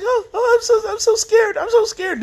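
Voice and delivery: high, hammed-up mock fright; mock scared. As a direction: squeak it out in sarcastic fake fear. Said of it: "and she's like".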